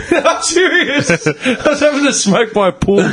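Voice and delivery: high, slow giggle through the words; laughing. Real, not acted. Laughing through his own story.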